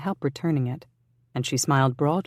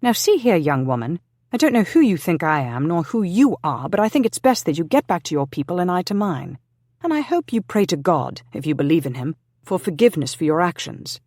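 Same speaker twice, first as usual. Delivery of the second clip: much higher and louder, stern rebuke; angry scolding; 4 semitones higher, 5 dB louder than usual. Acted. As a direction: scold her hard, like an angry guardian. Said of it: "Roger felt himself getting angry".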